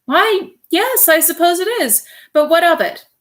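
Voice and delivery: a brisk so-what from the wife; lightly voiced wife, bemused; Mrs. Popper, puzzled. A narrator's reading.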